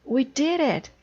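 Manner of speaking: high, bright shout of triumph; excited cry. Acted. Toad cheering to Frog as their kite climbs, excited cry. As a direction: cheer it out as the kite finally flies. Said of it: "cried Toad".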